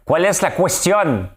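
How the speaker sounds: put-on loud, high, drawn-out Spanish-accented French; mock Spanish-French accent. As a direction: proclaim it slowly in a hammed-up Spanish accent. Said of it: "Avec, euh, mon accent espagnol, français".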